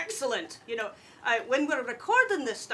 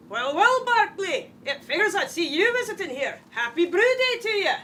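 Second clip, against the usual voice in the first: a lightly voiced hearty dwarf lass, higher; cheerful tavern greeting; merry dwarf woman hailing a friend; dwarf Brasi; 6 semitones higher, 4 dB louder than usual. Acting a dwarf commercial.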